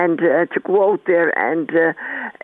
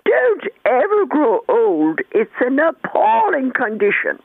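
slow, lightly done posh Anglo-Irish lady; a hint of a genteel Anglo-Irish drawl, slow; mild imitation of an elderly posh Anglo-Irish lady; posh Anglo-Irish accent; 4 semitones higher, slower than usual. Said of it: "in her very, very posh Anglo-Irish accent".

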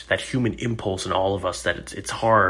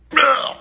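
sharp high frustrated ugh; frustrated grunt; 6 dB louder than usual. Retold or imitated. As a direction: let out an exasperated ugh. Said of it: "he goes".

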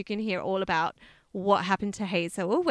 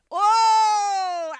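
long, high, loud wailed "oh"; loud wail of delighted shock; scandalised "oh"; 11 semitones higher, 6 dB louder than usual. Real, not acted. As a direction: let out a soaring, drawn-out "oh". Scandalised reaction after the revelation.

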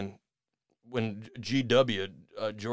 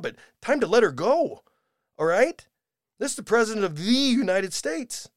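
higher and louder, building indignation; exasperated rant; 5 semitones higher, 5 dB louder than usual. Real, not acted. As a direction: vent to the audience, getting more worked up. Host rants about Biden's staff.

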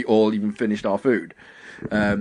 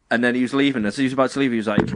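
normal talking voice

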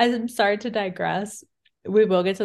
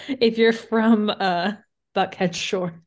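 slow, teasing Southern drawl; mock Southern drawl; 2 semitones higher, 4 dB louder, slower than usual. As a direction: stretch the words out in a put-on Southern drawl. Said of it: "a Southern drawl".

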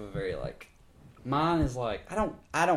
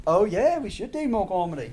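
put-on [name] accent; 8 semitones higher, 7 dB louder than usual. Accent bit drifting to [name].

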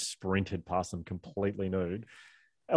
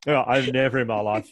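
ordinary delivery

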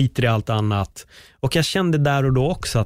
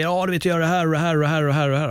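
mocking rattle-off; 5 semitones higher, much faster than usual. Mocking busy people's boasting.

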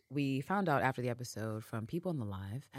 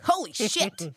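a sudden, louder, higher gasp of shock; shocked exclamation; 8 semitones higher, 8 dB louder, slower than usual. Retold or imitated. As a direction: blurt it out, floored. Stunned at recognizing a face.

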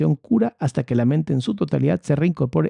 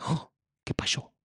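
whispered surprise; 10 dB quieter than usual. Retold or imitated. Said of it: "Y dices".

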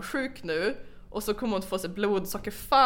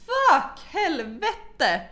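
high, loud drawn-out cursing; retold panicked swearing; 9 semitones higher, 4 dB louder, much slower than usual. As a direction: curse in mock horror, drawn out. Retold panic as grandmother vomits. (återberättat panikartat svärande)

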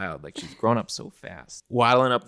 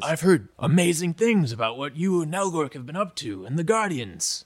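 higher, beaming fatherly praise; proud stepfather; 7 semitones higher than usual. Acted. Stepfather praising Zula and Nelgork, higher and beaming. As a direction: gush over the kids' deeds.

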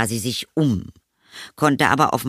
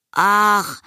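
put-on high, louder boyish groan of "ach"; put-upon child, pitched way up; reluctant boy; 11 semitones higher, 4 dB louder than usual. A narrator's reading. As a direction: whine it like a reluctant kid. Boy reluctant to come to tea.

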